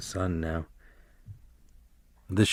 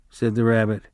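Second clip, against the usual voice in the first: ordinary delivery, nothing marked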